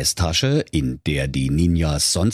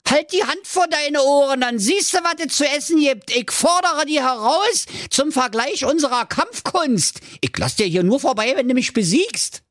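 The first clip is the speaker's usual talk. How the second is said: put-on high, heated Berlin accent challenge; angry Berlin ninja. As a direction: lay down the challenge. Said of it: "brüllte der Ninja".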